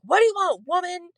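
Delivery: loud, high mock holler; joking stepdad. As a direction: imitate a stepdad jokingly calling back. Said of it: "my stepdad jokingly was like".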